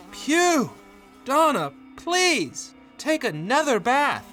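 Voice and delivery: high, drawn-out plea from the husband; disgusted pleading. Acted. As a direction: beg her, overcome by the stink. Smell returns, husband begs.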